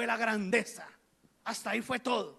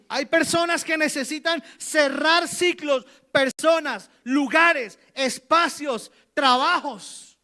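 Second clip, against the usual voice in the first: loud, hammering list, each word louder and slower; fiery sermon crescendo; preaching at full force; 3 semitones higher, 7 dB louder, slower than usual. Real, not acted. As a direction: drive each item home from the pulpit. Preacher building to a climax.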